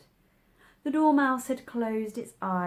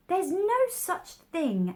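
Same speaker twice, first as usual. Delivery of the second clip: slow, higher indignant outburst; angry; 10 semitones higher, 4 dB louder, much slower than usual. A narrator's reading. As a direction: start angrily, drawing it out. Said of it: "Alice was beginning very angrily".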